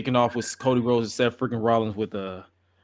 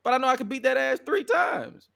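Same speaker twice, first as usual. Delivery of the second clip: high-pitched mock concession; playful, high-voiced retort impression; imitated defiant Drew; 11 semitones higher than usual. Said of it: "He like".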